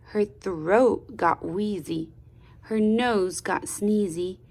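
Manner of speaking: ordinary delivery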